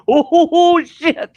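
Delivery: high, loud panicked "oh shit"; a frightened yell on a sliding car. Retold or imitated. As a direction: yelp it out in alarm. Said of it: "And I'm like".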